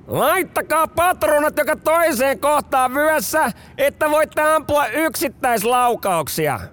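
high, slow barked range order; officer shouting. Retold or imitated. First lieutenant shouting orders at conscripts on the range. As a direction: bellow the order across the range. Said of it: "Yliluutnantti huutaa".

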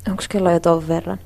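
ordinary delivery

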